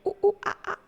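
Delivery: high-pitched monkey hooting; short, high mock monkey calls; monkey noises. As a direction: hoot like a little monkey. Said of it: "I am monkey".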